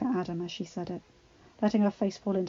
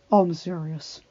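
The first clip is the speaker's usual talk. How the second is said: dark; 3 semitones lower, 5 dB louder, slower than usual. A narrator's reading. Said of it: "he said darkly".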